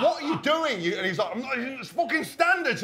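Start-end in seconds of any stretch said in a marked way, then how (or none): 0.0-0.8 high, loud half-laughing yell; laughing mock shout. Player shouting at Lewis mid-session, laughing mock shout. 1.3-2.8 mock indignant teammate. Lewis justifying his punches, hammed-up indignant voice. Imitating Lewis defending himself.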